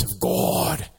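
slow, lightly boomed voice of God; a mild attempt at God's deep boom. Preacher voices God at the burning bush, slow and lightly booming. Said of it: "that's how it sounds in my head".

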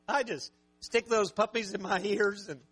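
ordinary talk, nothing marked

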